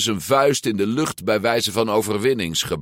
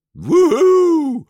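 high, loud gleeful "woohoo"; exhilarated whoop; joyful whoop; 9 dB louder than usual. A narrator's reading. Alberto racing downhill, joyful whoop. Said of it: "riep Alberto vrolijk".

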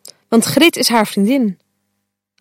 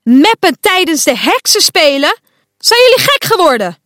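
loud, much higher angry scolding; boos roepen; 7 semitones higher, 3 dB louder than usual. A narrator's reading. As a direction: scold the whole class, voice rising. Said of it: "roept Quark". (boze, luide uitbrander)

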